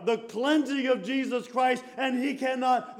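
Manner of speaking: usual speaking voice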